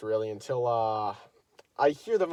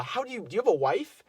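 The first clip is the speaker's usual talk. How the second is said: put-on girl's rephrased question; 9 semitones higher, 3 dB louder than usual. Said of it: "It was more".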